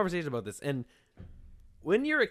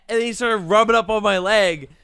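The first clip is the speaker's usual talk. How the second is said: ordinary talk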